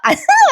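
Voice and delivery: quick high giggle; a burst of real giggling; short high laugh. Real, not acted. Laughs at own retelling. (खुदकन हसणे)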